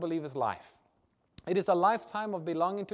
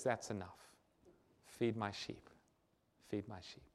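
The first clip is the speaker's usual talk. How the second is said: soft, much lower and quieter, tender; gentle Jesus; 8 semitones lower, 11 dB quieter than usual. Acted. Jesus telling Peter that's enough, softly and tenderly. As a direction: let it fall gently like a kind master.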